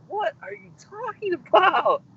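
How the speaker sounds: high, slow disbelieving question; incredulous. Retold or imitated. Son answering his dad's casual account of nearly drowning, incredulous and high. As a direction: stretch it out in disbelief. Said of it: "And I'm like".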